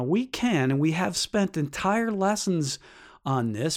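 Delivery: ordinary talk, nothing marked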